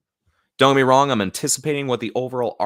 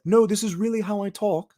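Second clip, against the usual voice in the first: much higher, put-on British voice; mock British accent; 14 semitones higher, 3 dB louder than usual. Stephen Grant insisting to his wife, high British accent. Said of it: "Drop the stupid accent".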